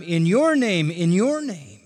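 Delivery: each "your" swooping up, falling on "name"; stress on YOUR. Shown as a demonstration. Preacher repeating the phrase each time, emphatic "your". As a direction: hit "your" both times. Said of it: "the you is emphatic each time".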